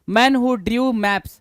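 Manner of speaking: reading the definition out very slowly; drawn out, each word separate; slow dictation. Shown as a demonstration. (बहुत धीमी आवाज़ में परिभाषा पढ़ना)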